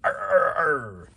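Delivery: high, loud pirate arr; Mr. Krabs announcing himself with loud, high pirate arrs; pirate growl. Acted. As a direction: growl it out like an old sea captain. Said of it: "Here comes your Krabs".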